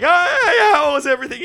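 high, loud sing-song server voice; chirpy waitress voice. Retold or imitated. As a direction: imitate an overly bright waitress. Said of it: "she's like".

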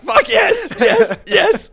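high, loud, laughing triumph; laughing victory yelp; gleeful cheer. Retold or imitated. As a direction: crow it with glee. Gleeful at not going.

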